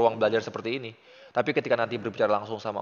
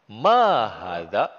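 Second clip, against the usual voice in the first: slow quiz question; 5 dB louder, much slower than usual. Shown as a demonstration. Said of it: "teacher quizzing student".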